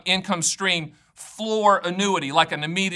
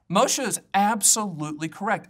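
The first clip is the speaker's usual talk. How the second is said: normal talking voice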